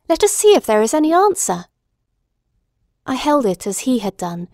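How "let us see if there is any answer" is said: higher, keen doctor; a hint of excitement; curious Watson; eager Watson. Watson takes the candle.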